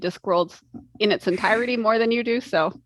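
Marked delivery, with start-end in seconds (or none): none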